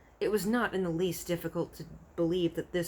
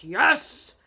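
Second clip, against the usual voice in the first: loud, higher booming yes; boomed; 9 semitones higher, 9 dB louder than usual. A narrator's reading. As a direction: boom it back. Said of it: "boomed the blood bottler".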